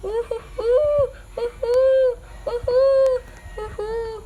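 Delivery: high, whimpering fake sobs; mock crying. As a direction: whimper like a wronged victim. Victim wailing after the robbery.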